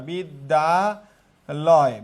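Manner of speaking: বিদ্যালয় extremely slow, each syllable held apart. Shown as a demonstration. Teacher showing three syllables, বিদ্যালয় very slowly broken up. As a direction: draw out বিদ্যালয় piece by piece. Teacher splits word again.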